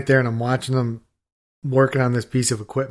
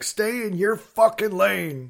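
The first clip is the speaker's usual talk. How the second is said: high, drawn-out mock yell; imitated angry telling-off; 8 semitones higher, slower than usual. Journeyman warning the safety guy off, mock yelling. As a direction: lay into him slowly, pitch raised. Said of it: "he's just laying it".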